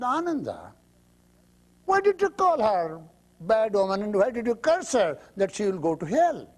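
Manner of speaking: high, loud reproach; rebuking Buddha. Acted. Buddha reproaching Ananda for cursing the woman, high and loud. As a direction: scold him from above.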